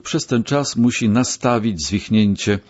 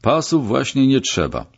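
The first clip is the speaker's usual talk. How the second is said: mild, raised-pitch call; 7 semitones higher than usual. A narrator's reading. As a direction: call it out lightly to halt the orderlies. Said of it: "zawołał, podbiegając". (lekkie zawołanie, wyższym głosem)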